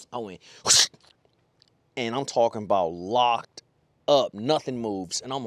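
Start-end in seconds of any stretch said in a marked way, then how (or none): 0.6-0.9 loud burst of air for a hookset; explosive whoosh. Retold or imitated. Angler acting out the hookset, explosive whoosh. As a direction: imitate the rod ripping up, all breath. Imitates setting the hook.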